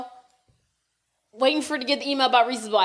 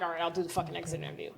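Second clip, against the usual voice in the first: grudging; 6 semitones lower, 12 dB quieter, faster than usual. Retold or imitated. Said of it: "and I'm like".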